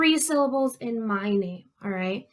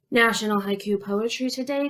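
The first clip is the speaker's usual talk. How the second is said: ordinary talk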